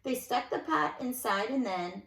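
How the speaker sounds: lilting rhymed read-aloud; sing-song storybook telling; story narration. A narrator's reading. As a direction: read it to children with bounce. Reads a rhyming picture book.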